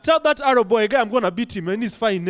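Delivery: ordinary delivery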